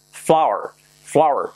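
flower as "FLAU-er", falling each time; correct pronunciation. Shown as a demonstration. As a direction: model flower clearly with an ow. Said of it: "Cuidado la pronunciación". (flower bien dicho, como "fláuer")

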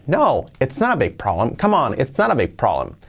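"No, it's not a big problem" with a lively rise and fall; with intonation. Shown as a demonstration. Teacher modelling natural intonation for students. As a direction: give it real reassuring tune, not a drill. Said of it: "le damos entonación".

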